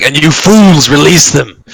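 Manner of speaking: loud, high, slow villain outburst; outraged dragon. Acted. Dragon berates the party for releasing ancient beings, outraged. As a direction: hurl the accusation with drawn-out force. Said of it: "He's like".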